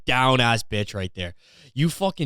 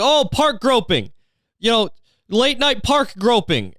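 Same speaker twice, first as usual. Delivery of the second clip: high, slow singsong call-out; breezy, hammed-up exclamation; blurted game answer; 8 semitones higher, slower than usual. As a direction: offer it like a fun party answer.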